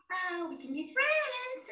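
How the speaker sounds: ordinary delivery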